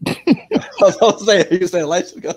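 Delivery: loud, high, laughing through the words. Real, not acted. Co-host reacting to confusion over whose turn it is, laughing. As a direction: crack up while explaining the mix-up.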